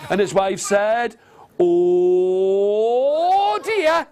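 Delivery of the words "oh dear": long "Oh" swooping up; dawning dismay. Acted. Wife realising her mistake, drawn-out rising dismay.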